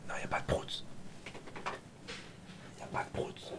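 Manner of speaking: playful voiceless whisper, close to the microphone. Real, not acted. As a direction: whisper it gently to your little one. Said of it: "ça doit faire bizarre que je chuchote".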